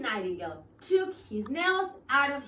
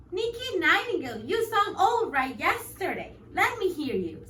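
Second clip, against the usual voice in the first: higher, small worm voice; tiny voice; 6 semitones higher, 4 dB louder than usual. Acted. Worm asks the bird to sing, in a tiny voice. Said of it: "the tiny voice asked".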